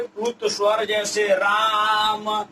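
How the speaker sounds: राम with the आ drawn out long; लंबा खींचा हुआ राम; stretched vowel. Shown as a demonstration. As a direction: hold the vowel in राम like calling out. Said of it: "ऐसे जैसे गाना वगैरह गाते हैं".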